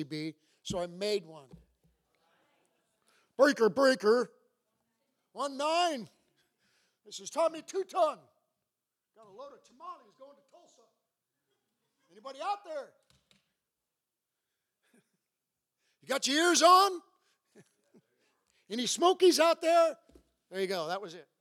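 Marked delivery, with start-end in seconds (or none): 3.4-12.9 lightly voiced trucker on the radio; hint of trucker radio chatter; CB trucker talk. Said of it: "They had that trucker talk". 16.0-17.0 high trucker radio call; CB trucker talk. Pretend. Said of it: "They had that trucker talk". 18.7-20.0 high trucker radio call; CB trucker talk. Pretend. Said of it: "They had that trucker talk".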